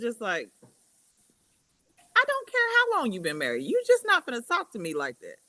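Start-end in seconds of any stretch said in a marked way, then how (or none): 2.2-5.4 high-pitched, louder indignant quote; indignant retort. Retold or imitated. Woman refusing to be talked to like that, indignant. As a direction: put your foot down, voice pitched way up. Acts out firm rebuke about marriage.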